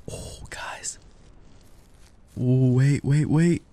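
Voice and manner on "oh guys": breathy whisper to the viewers; secretive horror-game whisper. Pretend. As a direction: drop to a hushed whisper. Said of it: "going back to this voice again".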